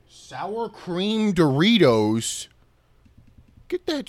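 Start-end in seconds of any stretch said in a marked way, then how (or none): 0.1-2.5 incredulous. Real, not acted. Disgusted disbelief at snack.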